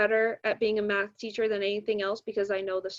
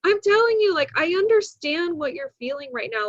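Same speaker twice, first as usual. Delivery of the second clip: high, loud retold plea; retold emphatic reassurance, voice raised; emphatic insistence; 7 semitones higher, 7 dB louder than usual. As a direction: insist so the student believes you. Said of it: "and I'm like".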